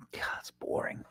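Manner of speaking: hushed, voiceless whisper; very soft confiding whisper; whispered aside. Real, not acted. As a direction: whisper a bored complaint under your breath. Hushed aside about playing guitar.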